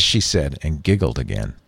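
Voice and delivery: plain reading voice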